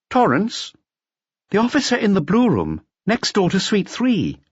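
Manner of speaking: very high-pitched switchboard employee; reply as the operator, voice lifted far up; high, helpful hotel clerk's voice; hotel receptionist. A narrator's reading. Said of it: "the receptionist explained".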